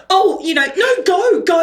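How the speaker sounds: high, shooing, hammed-up brush-off; dismissive mock. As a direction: wave her away in a squeaky voice. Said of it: "she was like".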